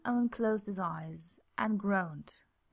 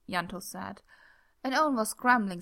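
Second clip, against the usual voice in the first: ordinary delivery, nothing marked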